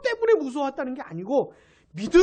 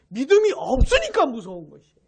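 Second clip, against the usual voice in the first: usual speaking voice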